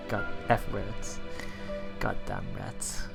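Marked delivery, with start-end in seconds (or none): none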